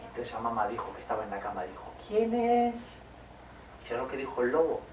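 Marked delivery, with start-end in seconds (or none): 2.1-2.8 high, louder mother asking who's there; a mum calling from bed, pitched up; mother's voice. Acted. Mum in bed calls out to the door, high voice. Mother answers knock in story.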